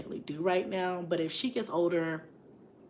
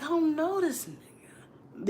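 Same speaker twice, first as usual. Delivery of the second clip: slow, dismissive whisper; disowning whisper; 10 semitones higher, slower than usual. Retold or imitated. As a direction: breathe it out slowly, brushing off a stranger. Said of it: "you just be like".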